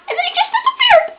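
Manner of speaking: very high, fast squeal; high squeak. Real, not acted. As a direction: squeak it out in a rush, upset. Upset squeak at character's vanishing.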